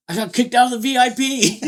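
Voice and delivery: high, laughing through the words; laughing quote. Retold or imitated. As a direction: quote him while cracking up. Retelling friend's complaint.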